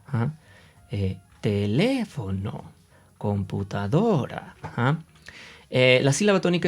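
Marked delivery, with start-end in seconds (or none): 1.4-4.6 "teléfono", "computadora" drawn out, pitch swooping up on LE and DO; slow, singsong stress on teléfono and computadora; exaggerated stressed syllable. Shown as a demonstration. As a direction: overdo the stress like a schoolteacher, slowly. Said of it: "hablar raro".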